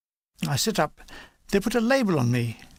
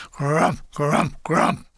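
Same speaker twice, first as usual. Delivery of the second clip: rolled, growled crump of shells; imitated mortar blasts; 2 semitones lower, much slower than usual. Narrator mimicking mortars landing on the hill, imitated blasts. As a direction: voice three mortar explosions.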